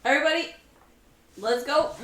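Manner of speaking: rallying call. Acted. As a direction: call the team into action. Player rallies the team.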